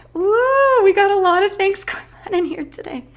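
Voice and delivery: high, loud whoop to shake it off; loud high cheer; excited woo. Real, not acted. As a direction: shout woo and laugh it off, high. Releasing emotion, shouting woo.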